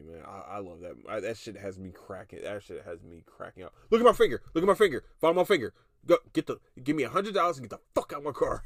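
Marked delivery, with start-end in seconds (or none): 3.9-8.6 high, loud quoted movie line; quoting the movie. Retold or imitated. Podcaster quoting The Night Before, loud and high. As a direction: act out the movie scene, loud. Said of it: "Y'all know I love that fucking movie".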